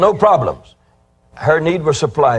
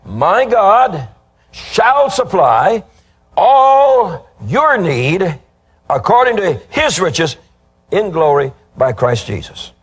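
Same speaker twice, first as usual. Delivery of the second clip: drawn-out preaching; 4 semitones higher, slower than usual. Acted. Preacher to congregation, repeating the verse, slow and soaring. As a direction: lean on all your need like a climax.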